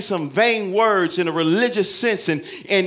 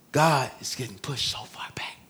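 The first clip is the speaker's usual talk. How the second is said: whisper; 4 semitones lower, 3 dB quieter than usual. Real, not acted. Sermon aside, unvoiced.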